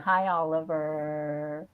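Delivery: low, slow, soft greeting; drawn-out hello. Shown as a demonstration. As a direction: stretch the name out low and slow. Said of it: "In case I didn't".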